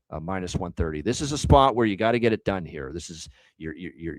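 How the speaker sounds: normal talking voice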